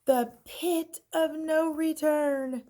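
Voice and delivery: high, slow dread-filled cry; cried out. A narrator's reading. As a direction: wail it like doom is near. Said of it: "everyone cried".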